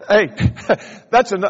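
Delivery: ordinary talk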